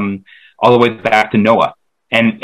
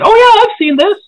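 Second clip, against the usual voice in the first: mock surprise, much higher and louder; surprised recognition; 4 dB louder than usual. Imitating himself finding a familiar video, high surprised squeak. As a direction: squeak it out like a sudden realization in an imitated voice. Retelling his own reaction to stumbling on videos.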